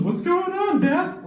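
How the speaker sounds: very high, chirpy fake greeting; mock cheery greeting. Greeting a college acquaintance, mock chirpy cheer. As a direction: squeal a fake-friendly hello.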